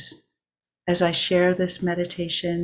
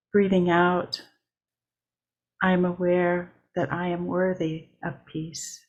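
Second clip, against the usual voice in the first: measured meditation read with long pauses between lines; steady mindful guiding voice, line by line; guided meditation. Acted. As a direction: lead the breathing lines unhurried, letting each settle. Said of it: "I will begin with a guided meditation".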